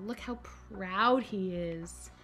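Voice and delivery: ordinary delivery, nothing marked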